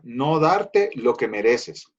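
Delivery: slow, deliberate restatement; restating the definition, slow and weighty; slow emphatic. Shown as a demonstration. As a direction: repeat it slowly so it sinks in. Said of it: "definition repeated".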